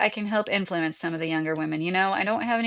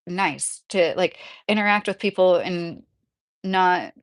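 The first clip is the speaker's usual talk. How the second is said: ordinary delivery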